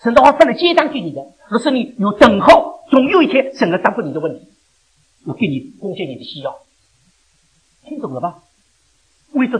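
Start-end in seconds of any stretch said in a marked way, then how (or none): 0.0-4.5 loud, high-pitched sermon proclamation; forceful preaching. Acted. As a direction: proclaim the promise to the congregation.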